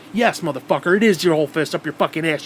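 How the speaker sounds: ordinary talk, nothing marked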